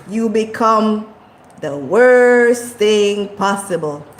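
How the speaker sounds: ordinary talk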